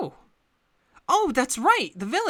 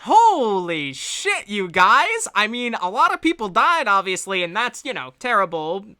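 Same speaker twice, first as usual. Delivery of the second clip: excited; 4 semitones higher, 5 dB louder than usual. Acted. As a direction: gush with astonished delight. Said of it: "pats you all on the back and says".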